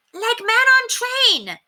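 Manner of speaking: put-on very high, slow drawn-out kid's voice; child's yell. A narrator's reading. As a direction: holler it like a delighted kid. Child yelling about feathers.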